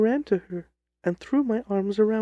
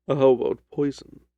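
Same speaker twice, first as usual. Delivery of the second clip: lower, measured detective voice; a calm, lower Holmes cutting in; 4 semitones lower, 5 dB louder than usual. Acted. Holmes questions the client.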